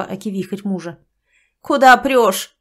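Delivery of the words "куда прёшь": much louder angry yell. Acted. Galina yells at her husband, loud and angry. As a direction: bark at the husband barging in. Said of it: "заорала Галина".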